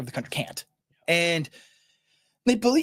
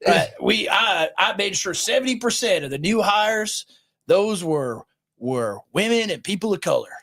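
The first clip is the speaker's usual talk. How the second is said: mock a Southern Baptist leader's accent, pitched up; J D Greear, Southern accent; 4 semitones higher than usual. Podcaster finishing his pastor impression, mock Southern accent. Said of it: "for doing this demeaning accent".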